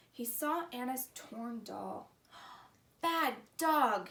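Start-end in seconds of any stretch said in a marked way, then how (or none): 3.0-4.1 a louder scold, cut short. Acted. Felix almost scolding the dog for the torn doll, loud. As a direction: snap at the dog then stop. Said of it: "he almost scolded".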